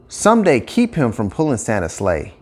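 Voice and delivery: ordinary delivery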